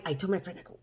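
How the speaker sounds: ordinary talk, nothing marked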